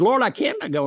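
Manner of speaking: cling on and refuse; a hammed-up terrified climber; panicked refusal. Man refuses God.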